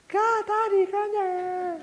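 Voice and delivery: high-pitched squealing crowd of relatives; imitated clamour, very high; noisy family greeting. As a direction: cheer like a whole excited family arriving. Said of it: "these noisy Mexicans".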